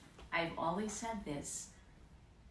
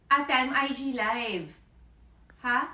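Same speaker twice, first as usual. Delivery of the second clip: louder call to the next room; raised voice calling sister; 5 semitones higher, 9 dB louder than usual. Real, not acted. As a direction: call out to your sister to get her attention.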